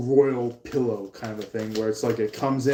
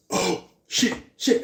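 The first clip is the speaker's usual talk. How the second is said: gasp, then a much higher panicked oh shit; sudden horrified realization, higher; 8 semitones higher than usual. Pretend. Person realizing it's their dish night, gasping in panic. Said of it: "this person that walked in was like".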